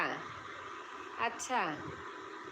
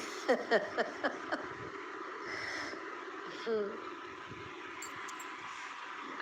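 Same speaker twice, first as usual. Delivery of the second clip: soft laugh; 3 semitones lower, 8 dB quieter than usual. Real, not acted. Laughing at own song remark.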